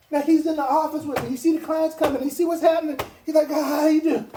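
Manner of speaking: high and loud, fired up; excited. Real, not acted. Telling the sales team about Fred's frustration, high loud excitement. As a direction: keep the energy way up.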